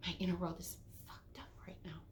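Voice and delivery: hushed, rushed whisper; panicked whisper. Retold or imitated. To herself at the paint counter, a rushed whisper. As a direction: whisper it fast to yourself, alarmed. Panicked inner thought in the store.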